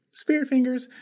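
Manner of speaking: high, bright spirit fingers; high squeal. Acted. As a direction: squeal it playfully. Showing off hands.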